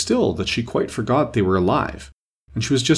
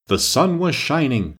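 poem recitation; 5 semitones higher, slower than usual. A narrator's reading. Tweedledee begins poem.

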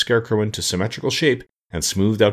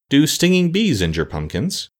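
higher, mildly anxious question; fearful; 7 semitones higher than usual. A narrator's reading. Jack asks about stinging bees, a little fearfully. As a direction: ask with a touch of worry. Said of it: "glancing around fearfully".

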